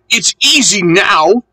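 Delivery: high, pleased engine voice; lightly acted glee; jubilant. A narrator's reading. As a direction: sound delighted at the relief. Engine rejoices after the climb.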